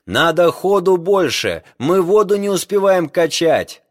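high, loud urgent shout; shouting over wind. A narrator's reading. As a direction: yell it through the wind. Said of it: "как он крикнул через ветер".

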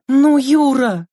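high, loud plea on the edge of tears; tearful cry. Acted. Lena begging Yura not to stay, a loud tearful cry. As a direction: cry out his name, near tears. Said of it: "закричала Лена со слезами в голосе".

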